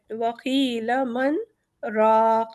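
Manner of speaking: slow Quranic recitation with a brief stop after مَنْ; recited with a pause. Shown as a demonstration. Teacher demonstrating saktah to students, slow recitation. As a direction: recite وَقِيلَ مَنْ, hold a short silent break, then رَاقٍ. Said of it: "سکتے کا حکم آیا ہے".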